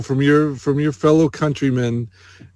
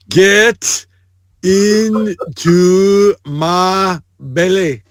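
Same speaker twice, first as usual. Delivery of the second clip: put-on drawn-out, higher Scottish accent; very slow, hammed-up Scots, word by word; mock Scottish; 5 semitones higher, much slower than usual. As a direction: stretch every word in Scottish. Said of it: "Oh, that's Scottish".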